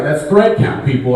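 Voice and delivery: usual speaking voice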